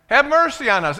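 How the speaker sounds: loud, high plea for attention; crying out. Acted. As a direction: call out like a begging blind man. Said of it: "And they kept doing that".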